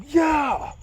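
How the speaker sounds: high, drawn-out celebratory yell; retold scream. Runner celebrating to everything around, triumphant scream. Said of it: "I just couldn't scream loud enough".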